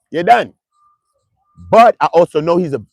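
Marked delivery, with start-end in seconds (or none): 0.1-0.5 luider "You're done"; louder verdict. Acted. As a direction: zeg het harder. Repeated louder.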